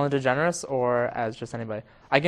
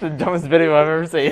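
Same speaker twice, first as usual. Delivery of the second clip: laughing; 7 dB louder, faster than usual. Real, not acted. Laughing at their own dumb video. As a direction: talk through real laughter.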